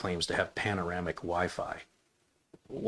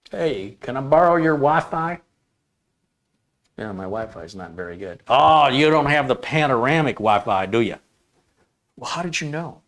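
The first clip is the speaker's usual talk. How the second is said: acted dialogue; 3 semitones higher, 6 dB louder than usual. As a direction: play two clueless neighbours. Acted dialogue between morons.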